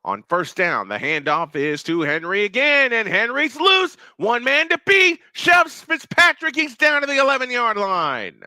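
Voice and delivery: building to a high, loud breakaway call; excited play-by-play. Real, not acted. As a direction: erupt as the runner breaks loose. Excited live call of long run.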